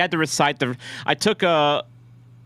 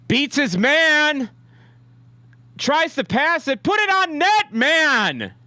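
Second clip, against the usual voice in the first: excited play-by-play; 11 semitones higher, slower than usual. Real, not acted. Fan watching his team attack, rising excited call. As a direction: call the chance like it might go in.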